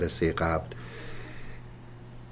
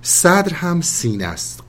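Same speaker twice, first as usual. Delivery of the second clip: usual speaking voice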